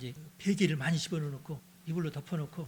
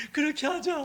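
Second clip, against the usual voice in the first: emphatic vow; 9 semitones higher, 5 dB louder than usual. Acted. Tearful farewell vow.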